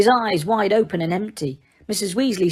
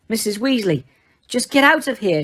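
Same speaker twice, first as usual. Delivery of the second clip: shouted; 3 semitones higher than usual. A narrator's reading. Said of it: "shouted Harry".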